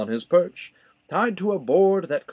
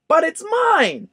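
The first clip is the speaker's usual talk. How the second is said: much higher, louder, slow shriek; screaming bird; 6 dB louder, slower than usual. A narrator's reading. Maisie demanding the egg, screaming. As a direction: claim it back with a scream. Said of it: "screamed the bird".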